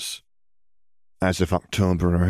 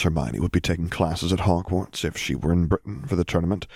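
plain reading voice

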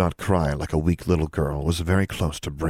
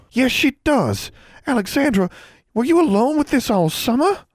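much higher, louder alarm; distraught; 5 dB louder than usual. A narrator's reading. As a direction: cry it out in appalled concern. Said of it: "she sounded quite distraught".

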